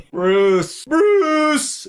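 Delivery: high shrill-pitched scream of the name Bruce; mock scream calling Bruce. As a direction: yell it like a sitcom boss losing it. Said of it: "when he screams, "Bruce".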